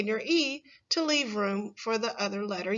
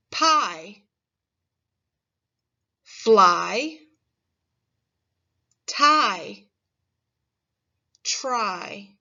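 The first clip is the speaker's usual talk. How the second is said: each word on its own, clear and louder; model words for repeating; 6 dB louder, much slower than usual. Shown as a demonstration. Teacher modelling long I words for children to repeat, clearly. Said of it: "Now repeat these words".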